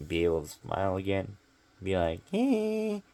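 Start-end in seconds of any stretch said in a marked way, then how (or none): 2.3-3.0 toothless grin squeak. Shown as a demonstration. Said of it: "And not be like".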